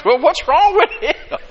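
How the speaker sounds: laughing mock puzzlement. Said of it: "I goes".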